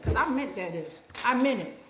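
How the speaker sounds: usual speaking voice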